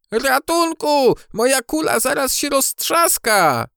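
very high, drawn-out cry of alarm; shouting for help. A narrator's reading. Beetle Benio sees his ball racing away, panicked shout. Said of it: "krzyczał żuczek na cały głos".

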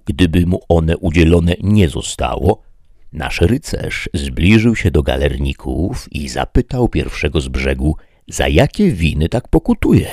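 ordinary delivery

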